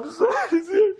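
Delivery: high-pitched hammed-up sobbing; mock crying. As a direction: wail it like a husband pretending to cry. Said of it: "He's crying".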